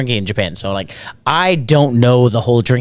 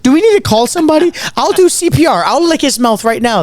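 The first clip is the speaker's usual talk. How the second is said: high, louder panicky voice; panicked offer; 11 semitones higher, 3 dB louder than usual. Retold or imitated. Young host panicking about a passed-out Jamie Foxx, high and frantic. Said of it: "I'm terrified".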